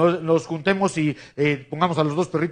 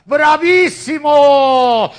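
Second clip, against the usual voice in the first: loud, long drawn-out cheer; bravo shout; 10 semitones higher, 9 dB louder, much slower than usual. Real, not acted. Cheering the magic show.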